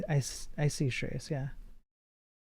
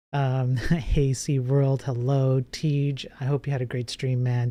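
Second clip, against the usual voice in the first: ordinary talk, nothing marked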